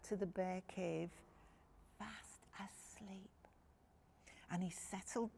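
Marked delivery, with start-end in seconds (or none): 2.0-3.5 1 whisper. A narrator's reading. Child fell asleep.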